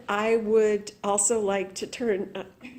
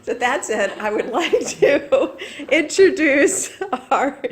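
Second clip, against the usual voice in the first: laughing; 6 semitones higher, 7 dB louder than usual. Real, not acted. Host laughing while introducing speaker.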